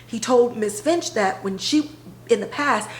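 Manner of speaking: ordinary talk, nothing marked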